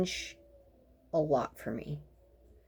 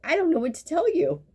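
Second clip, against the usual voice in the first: higher, louder, fed up; exasperated exclaim; 10 semitones higher, 3 dB louder than usual. Acted. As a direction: throw up your hands, half laughing. Mocking patient's surprise.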